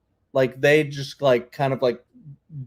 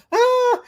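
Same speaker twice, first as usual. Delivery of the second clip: sudden high-pitched scream; imitated scream; 6 dB louder than usual. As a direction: shriek it out like a startled man. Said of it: "he'll just go".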